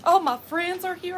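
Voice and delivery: ordinary talk, nothing marked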